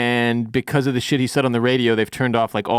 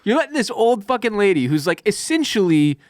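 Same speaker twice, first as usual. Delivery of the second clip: incredulous rant; 6 semitones higher than usual. Real, not acted.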